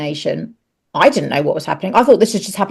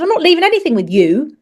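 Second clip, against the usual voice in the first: higher, firm retold refusal; retold defiance; 9 semitones higher than usual. Mother refusing her ex at the station, defiant. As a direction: refuse him, pointed and high.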